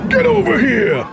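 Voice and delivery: high shouted ninja battle cry; scorpion voice. Pretend. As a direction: roar it like a vengeful spectre. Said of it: "my Scorpion impression".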